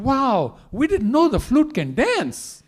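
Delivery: high, loud wonder; amazed gopis. Acted. Amazed gopis watching the flute dance.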